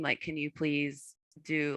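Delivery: ordinary delivery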